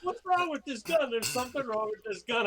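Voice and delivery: high, exasperated puzzlement; baffled at his own gun, voice climbing; frustrated disbelief. Retold or imitated. Retelling frustration at missing shots.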